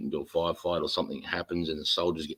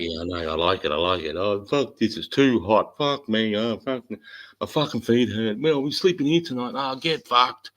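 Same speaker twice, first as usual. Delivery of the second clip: higher, mock-whiny grumbling soldier; a lightly voiced complaining soldier, pitched up; whinging soldier; 5 semitones higher than usual. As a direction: ham up a moaning digger in the heat. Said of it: "I'm like".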